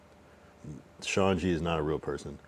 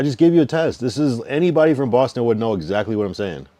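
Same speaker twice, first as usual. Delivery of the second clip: insistent; 5 semitones higher, 8 dB louder than usual. Real, not acted. Insisting at caller loudly.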